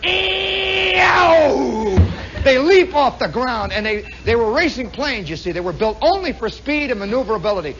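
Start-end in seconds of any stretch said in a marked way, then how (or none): 0.0-2.0 high, loud engine scream; airplane engine whine. Retold or imitated. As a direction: wail like a racing plane taking off. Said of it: "they went".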